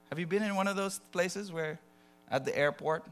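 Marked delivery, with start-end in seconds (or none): none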